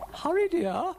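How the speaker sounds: high, loud singsong urging; high-pitched impatient dear-old-lady voice; urging lady character. Acted. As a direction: chivvy in a high lilt. Ermyntrude urges Dougal on.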